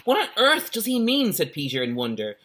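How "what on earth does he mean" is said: put-on child's wondering voice. Peter wondering aloud.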